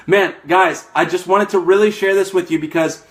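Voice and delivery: ordinary delivery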